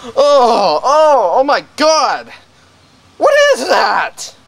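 high panicked squeal; terrified shriek. Acted. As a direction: shriek in cartoon terror. Visitor cries out at prize.

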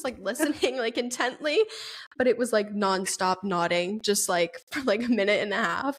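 usual speaking voice

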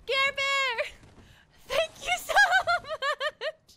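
high, loud, breaking into laughter; excited thanks. Real, not acted. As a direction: cry it out slowly and laughingly. Thanks after surprise, breaks into laugh.

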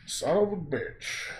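slow gleeful curse; savoured swear. Shown as a demonstration. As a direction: roll the swear out slowly with relish. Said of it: "Everyone say it with me".

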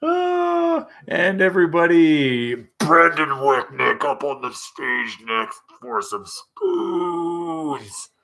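hammed-up concert announcer; stage announcer. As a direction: introduce him like an MC hyping the next act. Mock stage announcement of Brandon.

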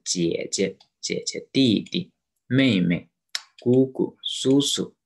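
姐姐, 弟弟, 妹妹, 姑姑, 叔叔 punched on the first syllable, light on the second; neutral-tone demo. Shown as a demonstration. Teacher drills family words, 妹妹 and the others ending in neutral tone. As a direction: hit the first of each pair in 姑姑, 叔叔, release the second. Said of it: "the neutral tone".

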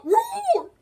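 high mock tiger roar, loud. Player voicing Tiger in a game, high roar.

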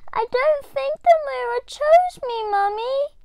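put-on high child's voice, let down; a little girl feeling let down; disappointed. A narrator's reading. Little Diane telling mum the mirror ignored her. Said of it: "she said disappointedly".

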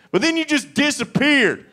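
sudden high, loud cry; anguished wail. Pretend. Man mourning love that left, high wail. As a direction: wail the loss.

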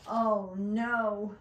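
storybook cry of dismay; lightly acted alarm; mock dismay. Turkey dreads being Christmas dinner.

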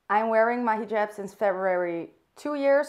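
ordinary talk

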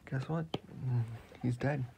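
ordinary delivery

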